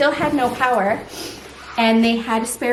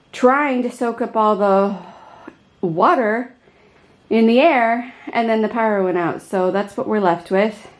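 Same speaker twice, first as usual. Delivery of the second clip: ordinary delivery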